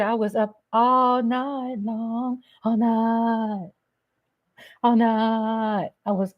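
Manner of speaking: ordinary talk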